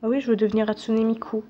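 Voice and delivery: usual speaking voice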